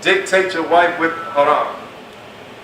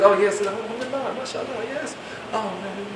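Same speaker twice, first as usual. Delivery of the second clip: soft, breathy-sounding mock flirting, much softer; mock flirty sweet talk; 3 semitones higher, 10 dB quieter than usual. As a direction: coo at the sister like a smitten brother. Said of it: "talk to the sisters like this".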